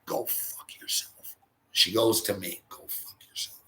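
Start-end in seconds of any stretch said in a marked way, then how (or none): 0.1-1.4 slow, voiceless mouthing of an insult; mouthed whisper. Retold or imitated. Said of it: "she lips it though". 2.7-3.6 barely audible, voiceless mouthing; mouthed whisper. Retold or imitated. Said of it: "she lips it though".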